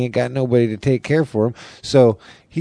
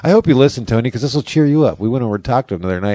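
ordinary talk, nothing marked

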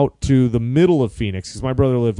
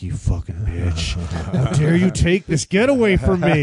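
inner rage. Retold or imitated. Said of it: "But inside, I'm like".

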